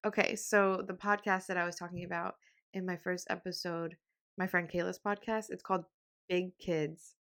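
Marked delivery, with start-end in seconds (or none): none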